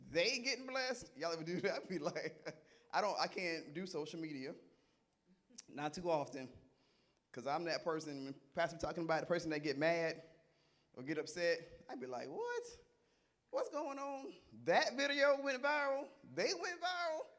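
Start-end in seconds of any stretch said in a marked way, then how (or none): 0.1-1.1 mock disbelief. Acts out envy at others' blessings. 12.3-17.3 high-pitched, scandalized disbelief; hammed-up astonishment, pitched way up; mock incredulous. Acts out jealous reaction to social media.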